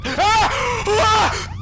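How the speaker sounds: high, loud frightened scream; screaming in real fright. Real, not acted. Jump scare in horror game.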